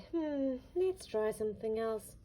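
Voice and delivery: sighing. Acted. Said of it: "Dora sighed a big sigh".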